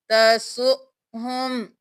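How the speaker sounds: तसुहुम slow, with a catch on the hamza; Quranic recitation. Shown as a demonstration. Teacher demonstrating the stop, recited slowly. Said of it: "हमजा के ऊपर जज़म है, झटका देकर पढ़ेंगे".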